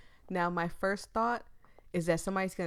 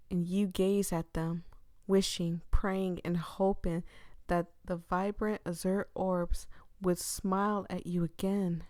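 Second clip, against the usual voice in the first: normal talking voice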